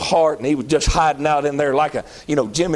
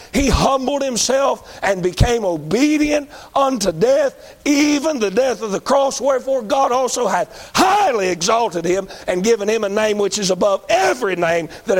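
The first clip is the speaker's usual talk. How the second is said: fervent scripture reading; 5 semitones higher than usual. Acted. Preacher reading Philippians to the congregation, rising fervor. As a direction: preach the verse up toward the cross.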